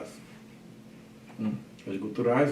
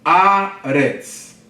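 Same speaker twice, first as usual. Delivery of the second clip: Haaretz broken into ha, a, retz, loud; syllable division; 4 semitones higher, 7 dB louder than usual. Shown as a demonstration. Teacher showing syllable division, Haaretz in separated syllables. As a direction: separate each syllable of Haaretz with small gaps. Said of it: "Olha a divisão silábica".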